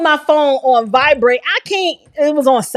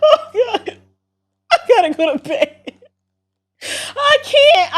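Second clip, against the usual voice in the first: helpless laughing; 9 semitones higher than usual. Real, not acted. Streamer saying she must go to bed, overcome with laughter. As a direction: talk weakly through real laughter.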